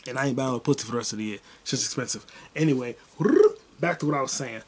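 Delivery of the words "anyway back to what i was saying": ordinary talk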